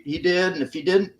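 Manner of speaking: normal talking voice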